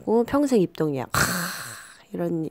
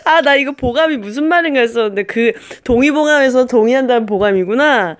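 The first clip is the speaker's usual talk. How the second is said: laughing; 5 semitones higher, 6 dB louder than usual. Real, not acted. Gets the 동의보감 joke.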